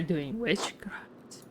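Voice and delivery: whispered, voiceless and hushed. Shown as a demonstration. Whispering the witchcraft question to the prior. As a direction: hush it like a secret accusation. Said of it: "let's whisper that".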